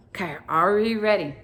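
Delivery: ordinary talk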